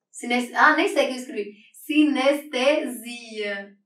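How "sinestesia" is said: "Sinestesia" drawn out syllable by syllable, very slow; slow dictation. Shown as a demonstration. Teacher slowly dictating a term while writing on the board. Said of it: "Gente, eu escrevi sinestésica".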